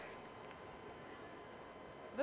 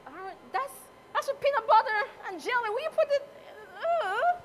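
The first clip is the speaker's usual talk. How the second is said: high, fast and loud, half-laughing outrage; retold disbelief; 10 semitones higher, 4 dB louder than usual. As a child, shocked at her brother's marmalade lamb, in high mock outrage. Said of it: "And I, I was like".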